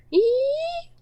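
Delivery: sudden high-pitched squeal of pain; high shriek. Acted. Student struck by his tutor, high startled shriek. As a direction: yelp as if hit on the head. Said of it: "Chuna let out a shriek".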